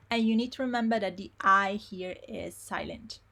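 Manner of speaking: ordinary delivery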